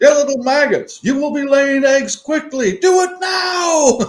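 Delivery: barking high, loud drill instructor; drill sergeant. Pretend. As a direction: yell at the hens like boot camp. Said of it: "put on a campaign hat like a drill instructor".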